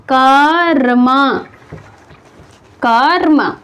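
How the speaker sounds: कर्म stretched slowly as kaa-rma; drawn-out pronunciation. Shown as a demonstration. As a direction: lengthen the first part of कर्म, then र into म. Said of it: "इसका उच्चारण क्या करते हैं". (कर्म को धीरे-धीरे खींचकर बोलें)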